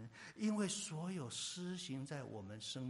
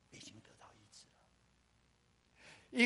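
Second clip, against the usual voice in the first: normal talking voice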